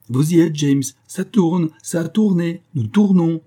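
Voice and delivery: loud, high, excited announcement; impetuous cry. A narrator's reading. Calinette telling James they are filming, impetuous cry. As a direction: ring it out, each phrase high and eager. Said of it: "cria l'impétueuse Calinette".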